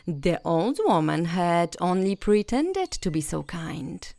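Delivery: ordinary delivery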